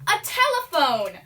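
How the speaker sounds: loud emphatic repeat. Acted. Repeats for confused cohost.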